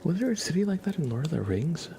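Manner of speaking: ordinary delivery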